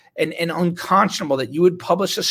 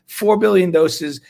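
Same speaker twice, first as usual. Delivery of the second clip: usual speaking voice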